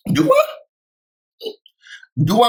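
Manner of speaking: very high, squeaky mock-confused question; mock clueless. Playing Wendy answering Andy's remark, mock clueless.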